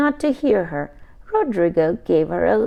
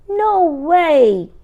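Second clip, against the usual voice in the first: higher, louder no way; loud refusal; 9 semitones higher, 8 dB louder than usual. Acted. Brother refusing to hammer nails, loud high shout.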